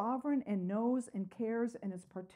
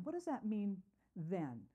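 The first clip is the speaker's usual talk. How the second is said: ordinary talk, nothing marked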